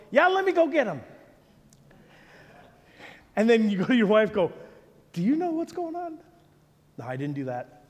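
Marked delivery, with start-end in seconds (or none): 0.1-1.0 high-pitched put-on breeziness; feigned casual reply. Acted reply to stranger at door. 5.1-6.2 high, hushed acted question; puzzled aside.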